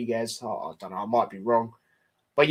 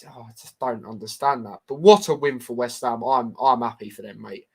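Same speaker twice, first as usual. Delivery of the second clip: ordinary talk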